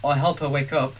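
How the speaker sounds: soft, low reading of the story text; subdued visual novel narration; game narration read. Acted. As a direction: read the inner monologue quietly to yourself.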